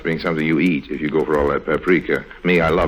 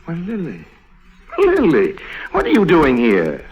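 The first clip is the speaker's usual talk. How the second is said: surprised recognition; 10 semitones higher, 4 dB louder than usual. Acted. Harry recognizes Lily in the flower shop, surprised. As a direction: pitch jumps up on Lily, startled and delighted.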